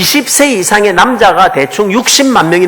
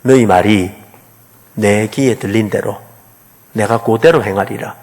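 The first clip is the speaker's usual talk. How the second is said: God speaking; 11 semitones lower, 6 dB quieter than usual. Acted. God's voice quoted in sermon.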